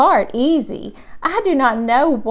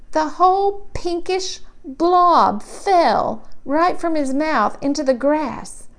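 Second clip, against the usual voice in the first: ordinary delivery, nothing marked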